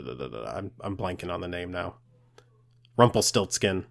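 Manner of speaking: ordinary talk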